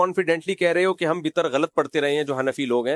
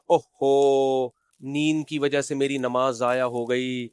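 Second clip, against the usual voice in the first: long, hammed-up "oh no" of regret; mock dismay; 3 semitones lower, slower than usual. Preacher imitating someone who slept through prayer, mock dismay. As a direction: put on slow, droning mock sorrow. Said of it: "اوفو کر کے acting".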